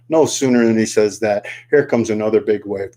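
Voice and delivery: usual speaking voice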